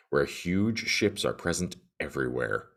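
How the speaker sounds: ordinary talk, nothing marked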